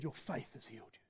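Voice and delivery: a near-whispered blessing; hushed. Acted. Jesus' words said very softly.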